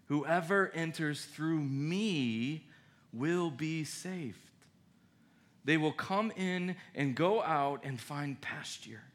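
slow, quiet scripture read. Acted. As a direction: read the verse softly and slowly. Reading scripture slowly.

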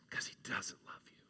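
sinister whisper of an inner doubt. Acted. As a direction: hiss it like a voice in your head. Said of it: "our doubt inside says".

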